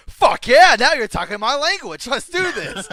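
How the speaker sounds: high, loud, pumped-up yell; hyped shout. Retold or imitated. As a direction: roar it like a villain who's thrilled to fight. Cell quote in retelling.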